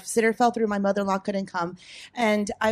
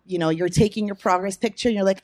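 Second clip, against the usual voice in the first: ordinary talk, nothing marked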